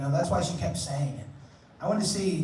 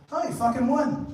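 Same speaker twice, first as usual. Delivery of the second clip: dad's surprised mumble; 7 semitones higher than usual. Retold or imitated. Dad behind camera.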